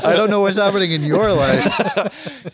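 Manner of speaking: slow laughing mock complaint. Real, not acted. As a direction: whine it playfully, slowly. Joking complaint to parents.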